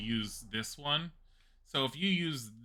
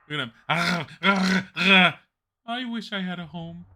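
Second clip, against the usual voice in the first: high, very slow, loud pleading puppy voice; higher pretend stray-dog whimper; pitiful puppy; 8 semitones higher, 10 dB louder, slower than usual. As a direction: voice the sad wolf begging. Voicing a pitiful wolf.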